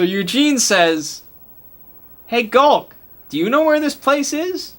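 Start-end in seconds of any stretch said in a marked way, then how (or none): 2.3-4.7 hopeful high ask. Acted. Eugene asks the creature.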